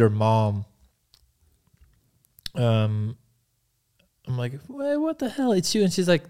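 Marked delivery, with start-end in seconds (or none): 4.7-5.8 high-pitched mock disbelief; astonished recognition. Man bumping into her again in the park, high astonished greeting. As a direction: squeak out your surprise at who it is.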